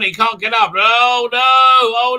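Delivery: normal talking voice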